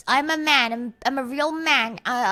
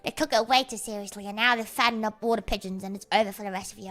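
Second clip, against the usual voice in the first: normal talking voice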